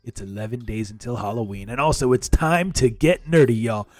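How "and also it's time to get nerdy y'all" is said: booming catchphrase. Acted. Host says the catchphrase live.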